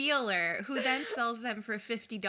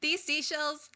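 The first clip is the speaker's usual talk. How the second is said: excited quote; 6 semitones higher, 6 dB louder than usual. Retold or imitated. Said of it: "she goes like".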